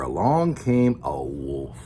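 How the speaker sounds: low, slow, ominous reveal; ominous storytelling. A narrator's reading. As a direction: drop low and draw out the wolf's arrival. Storyteller introduces the villain wolf.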